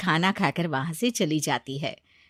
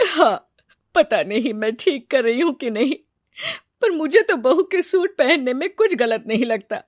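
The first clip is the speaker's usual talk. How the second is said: louder tearful complaint, rising in pitch; upset, crying; 4 semitones higher, 7 dB louder than usual. A narrator's reading. Mother-in-law asks her husband whether she erred, acted crying. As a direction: plead your case, building in distress. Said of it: "परेशान होकर रोने लगती है".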